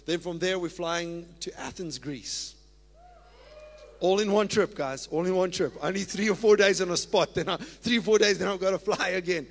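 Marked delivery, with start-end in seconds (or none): 4.0-9.4 loud, fervent announcement to the congregation; preaching at full force. Real, not acted. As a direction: proclaim it loudly, stirring the church.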